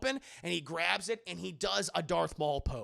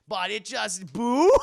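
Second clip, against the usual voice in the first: normal talking voice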